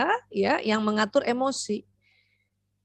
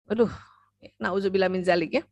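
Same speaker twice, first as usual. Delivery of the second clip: usual speaking voice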